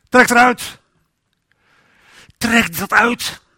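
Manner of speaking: high, loud, slow divine command; commanding God voice. Acted. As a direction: thunder it out as God's order. God commands leaving sin behind.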